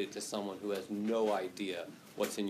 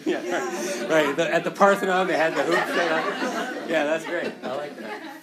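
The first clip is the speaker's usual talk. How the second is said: laughing; 4 semitones higher, 10 dB louder than usual. Real, not acted. Laughing at colleague's basketball joke.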